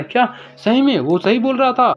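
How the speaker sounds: high, astonished question; disbelieving thought. Acted. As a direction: ask yourself in amazement. Jin wonders in disbelief.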